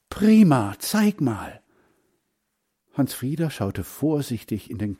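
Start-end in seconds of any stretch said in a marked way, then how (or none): 0.1-1.6 high, eager storybook exclamation; excited. A narrator's reading. Boy hears his rabbits have arrived, excited. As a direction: burst out, keen to see.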